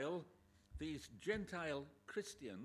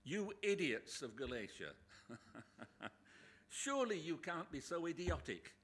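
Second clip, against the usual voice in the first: blunt insult; 4 semitones higher, 6 dB louder than usual. Shown as a demonstration. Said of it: "J B Phillips' translation is this".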